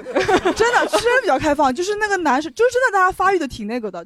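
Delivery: an embarrassed, laughing confession. Real, not acted. Laughing as she admits to the hosts how open her school was.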